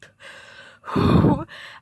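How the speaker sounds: loud, long emotional sigh; heavy sigh. Real, not acted. After recalling grandma's last words, a heavy sigh. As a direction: let the breath out shaking with grief.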